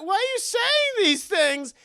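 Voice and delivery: high, loud, slow mock wail; imitated distraught dad. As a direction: wail it as a horrified father. Imitates father horrified by Esther.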